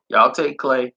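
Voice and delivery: usual speaking voice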